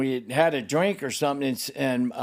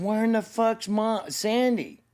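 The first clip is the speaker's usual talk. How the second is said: high, heated retold demand; pitched-up furious retelling; angry demand; 9 semitones higher than usual. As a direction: fire the question at him. Retold furious call to friend.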